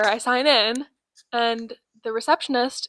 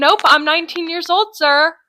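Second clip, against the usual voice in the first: higher, louder, prim correction; mock prim reply; 6 semitones higher, 8 dB louder than usual. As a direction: correct him sweetly but firmly. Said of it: "And I said".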